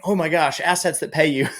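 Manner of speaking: high, delighted revelation; excited realization. Retold or imitated. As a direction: discover it with a rush of excitement. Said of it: "And I went".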